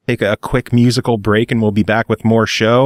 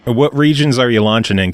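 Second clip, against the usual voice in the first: ordinary delivery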